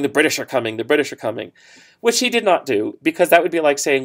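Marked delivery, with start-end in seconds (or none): none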